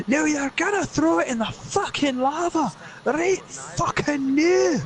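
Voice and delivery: high, slow, furious Scots rant ending in a shout; furious Scottish yell. Acted. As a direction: explode at the greedy elf.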